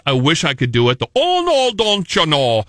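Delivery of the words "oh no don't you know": Upper Midwest vowels, sing-song; lightly put-on Wisconsin accent. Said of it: "they have such thick Wisconsin accents".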